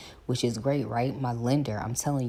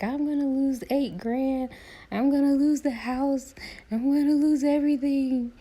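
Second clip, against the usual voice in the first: much higher, louder despair; hammed-up wailing; 6 dB louder than usual. Telling best friend her fears, hammed-up high wail. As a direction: wail it dramatically. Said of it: "And I was like".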